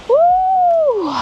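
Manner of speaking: high, loud joyful woo; excited whoop. Real, not acted. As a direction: let out a happy cheer. Excited reaching the waterfall.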